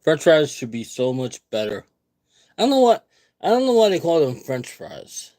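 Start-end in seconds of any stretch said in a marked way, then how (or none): 2.6-5.3 higher and louder, baffled complaint; exasperated rant. Real, not acted. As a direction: throw your hands up over the name. Rant about French fries.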